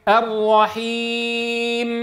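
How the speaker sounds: slow recited "الرحيم" ending on a still ميم; recited pause form. Shown as a demonstration. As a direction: draw out "الرحيم" in recitation style. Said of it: "كما في قوله تعالى".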